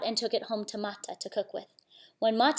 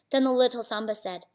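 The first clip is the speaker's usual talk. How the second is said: plain reading voice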